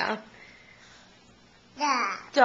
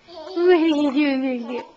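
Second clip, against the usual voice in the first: higher, louder, bright teasing repeat; playful sing-song; 5 semitones higher, 4 dB louder than usual. Real, not acted. Parent playfully calls to toddler behind the chair, higher and louder. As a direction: call it out playfully to get him to peek.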